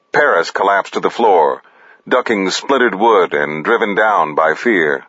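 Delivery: ordinary delivery, nothing marked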